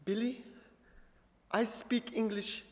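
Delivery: soft, slow, careful words; halting confession. Retold or imitated. Host mom comforts Billy in English, soft and halting. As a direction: pick out each word as if new to English.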